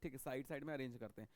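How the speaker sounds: ordinary talk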